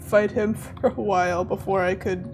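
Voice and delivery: ordinary talk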